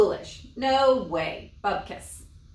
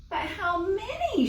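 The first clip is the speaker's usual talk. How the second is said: much higher, desperate wail; 12 semitones higher than usual. Acted. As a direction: wail as if all is lost. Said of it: "she wailed".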